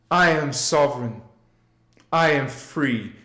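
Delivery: calm repeat-after-me chant; group affirmation. Shown as a demonstration. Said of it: "Say it with me".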